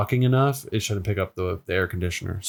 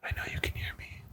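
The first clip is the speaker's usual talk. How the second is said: soft breathy ASMR whisper; 11 semitones higher, 4 dB quieter than usual. Pretend. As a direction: whisper close to the mic, soothing. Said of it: "I could create an A S M R channel now".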